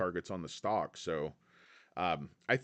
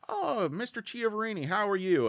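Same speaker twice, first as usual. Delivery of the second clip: fawning analyst greeting; 8 semitones higher than usual. Retold or imitated. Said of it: "And you go".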